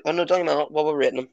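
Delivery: normal talking voice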